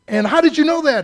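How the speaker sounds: mock accusing church member. Indignant church member confronting preacher.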